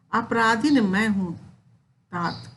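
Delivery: poem recitation. Acted. Teacher reciting Kaikeyi's plea to Ram, measured poem recitation.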